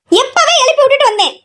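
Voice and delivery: high-pitched scolding call. Acted. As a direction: throw it toward the bedroom. Repeats line, now calling out. (உயர்ந்த குரலில் கூப்பிடுதல்)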